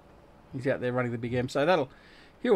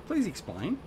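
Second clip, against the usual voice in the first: a poor, lightly voiced mock of a politician's plea, pitched up; mock-plead like an Australian politician, rising at the end; Pauline Hanson impression; 8 semitones higher, 8 dB quieter, slower than usual. Gamer jokingly imitates a politician to a friend, poor impression. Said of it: "my very poor impersonation of Pauline Hanson".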